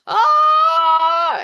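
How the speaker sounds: high loud overwhelmed cry; emotional cry. Real, not acted. Overcome while recalling the movie ending, a loud high cry. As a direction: let the feeling burst out in a cry.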